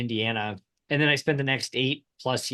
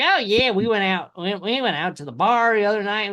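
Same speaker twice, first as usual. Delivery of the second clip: higher Indiana twang imitation; brother's twang; 6 semitones higher, 3 dB louder than usual. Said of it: "Where did that twang come from".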